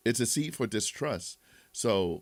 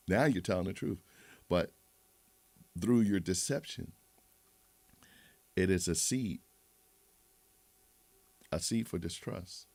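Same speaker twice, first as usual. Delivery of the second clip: normal talking voice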